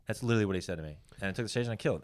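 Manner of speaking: ordinary talk, nothing marked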